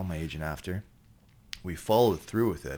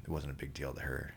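usual speaking voice

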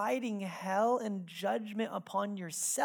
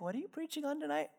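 sweetly pointed; 8 semitones higher, 7 dB quieter, faster than usual. Retold or imitated. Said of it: "Jen just so sweetly".

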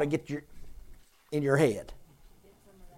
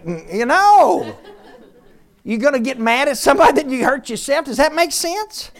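laughing; 7 semitones higher, 7 dB louder than usual. Real, not acted. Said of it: "laughing while preaching".

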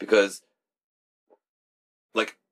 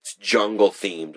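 ordinary talk, nothing marked

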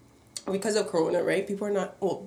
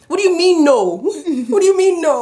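high, loud upset demand; upset shout; 8 semitones higher, 8 dB louder than usual. Retold or imitated. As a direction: shout it indignantly. Upset lady voiced.

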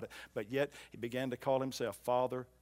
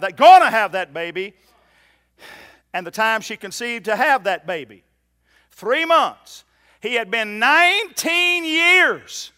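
loud, ringing preacher's build, slow on the figures; preaching at full force; 10 semitones higher, 17 dB louder than usual. Acted. Preacher thundering the timeline at the congregation, full force. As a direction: hammer the three months home to the church.

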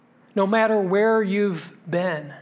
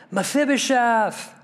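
higher voice, calling out the name; King David calling; 4 semitones higher than usual. Acted. King David greeting Mephibosheth bowed before him, calling out. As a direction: call the name out as the king would.

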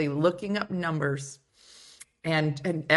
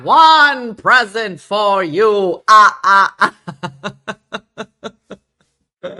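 slow, much louder mock announcement breaking into laughter; hammed-up present giver; 2 semitones higher, 13 dB louder, much slower than usual. Shown to a livestream audience, a much louder and slow mock gift reveal. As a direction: play the proud gift-giver, dragging it out.

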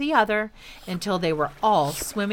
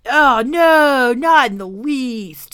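higher, louder, slow crocodile voice; obliging crocodile; 6 semitones higher, 9 dB louder, much slower than usual. Acted. Crocodile happily agreeing, slow and grand.

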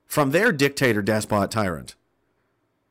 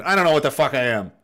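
ordinary delivery

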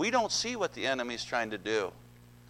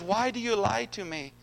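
higher, pleading whine; whiny Delilah; 9 semitones higher, 3 dB louder than usual. Acted. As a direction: whine it like a sulking lover. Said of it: "she whined and whined and whined".

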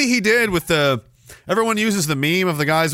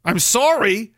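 ordinary talk

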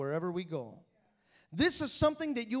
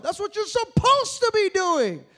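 slow, loud climactic refrain; That's what you're supposed to be doing, loud and slow; loud preaching refrain; 11 semitones higher, 12 dB louder than usual. Acted. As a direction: hammer the line home at the peak. Refrain at sermon peak.

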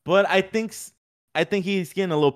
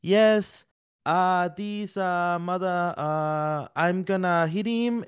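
higher, slow and hesitant, groping for words; halting non-native English; 6 semitones higher, much slower than usual. Retold or imitated. Ilya at the English press conference, halting and uncommanding. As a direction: stumble through the English like a fighter lacking the words. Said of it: "But in English, he's like".

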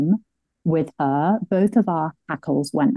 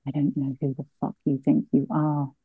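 soft-spoken retort; 3 semitones lower, 7 dB quieter than usual. Retold or imitated. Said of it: "soft-spoken woman".

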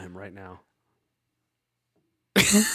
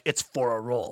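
imitating Jared Leto; 3 semitones lower, 3 dB quieter than usual. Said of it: "pull out a knife and be like".

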